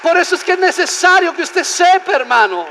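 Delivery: loud, high sermon climax; full-force preaching. Real, not acted. Preacher urging brethren to praise their rescuer, full-force preaching. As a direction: cry it out to the congregation with mounting fervor.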